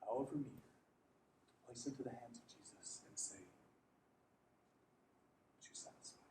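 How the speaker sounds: hushed, breathy whisper; barely-there voice. Acted. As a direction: whisper it like a private prayer. Quiet prayer-like offering to Jesus.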